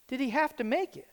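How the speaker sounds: usual speaking voice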